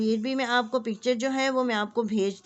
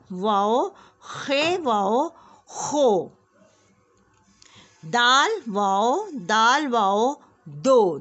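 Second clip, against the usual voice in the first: phonics drill; much slower than usual. Shown as a demonstration. Teacher drilling KG class on joining letters with वाव. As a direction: sound out each letter pair for small children. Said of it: "अब देखिए, गौर से सुने".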